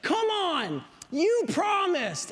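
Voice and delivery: put-on high, drawn-out childish complaint; indignant protest. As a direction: whine it like a wronged kid. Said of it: "You've heard toddlers say that".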